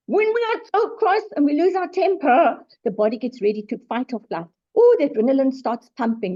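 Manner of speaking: usual speaking voice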